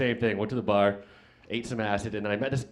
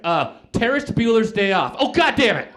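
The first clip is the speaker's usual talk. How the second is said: high, loud blurt building to a yell; exasperated outburst; 8 semitones higher, 7 dB louder than usual. Acted. Some guy blurts out a movie title, exasperated outburst. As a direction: let it burst out high and loud.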